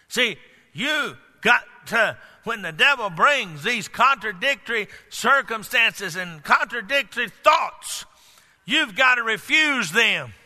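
high, emphatic preacher's delivery; forceful preaching. Acted. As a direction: preach it hard, punching the key words.

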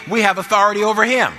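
high, loud, triumphant declaration; emphatic preaching shout. Real, not acted. Preacher repeats the point for a better amen, emphatic preaching shout. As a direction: raise it right up to rouse the church. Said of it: "ought to have a better amen than that".

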